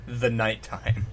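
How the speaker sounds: "The" drawn out and weighted before "nighttime"; mock-dramatic "The nighttime"; stress on "The". Shown as a demonstration. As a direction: hit "The" like a grand title. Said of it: "Not, like, just nighttime".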